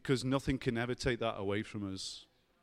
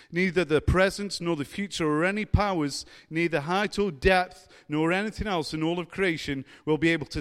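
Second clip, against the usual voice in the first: loud, raised-pitch declaiming of scripture; impassioned scripture reading; 5 semitones higher, 7 dB louder than usual. Real, not acted. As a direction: proclaim the verse like a fired-up preacher.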